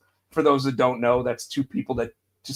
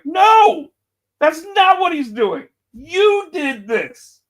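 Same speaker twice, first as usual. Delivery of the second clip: much higher, loud insistent no; mock angry retort; 13 semitones higher, 7 dB louder, slower than usual. Imitating the Usos snapping back, higher and louder. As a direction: fire back high and slowing to the end. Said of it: "and it's like".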